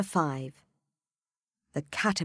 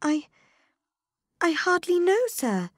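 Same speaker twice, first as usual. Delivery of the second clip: put-on young Alice; 12 semitones higher, 5 dB louder than usual. A narrator's reading. Alice voiced in story.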